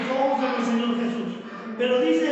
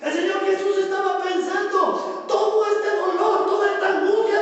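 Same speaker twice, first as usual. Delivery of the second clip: impassioned preaching; 4 semitones higher than usual. Acted. Pastor voicing Jesus' thoughts on pain and joy, impassioned preaching. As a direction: pour out the anguish and hope.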